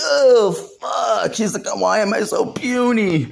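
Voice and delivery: high crying whine; hammed-up high sob; whiny. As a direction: whimper like you just lost. Batman whining.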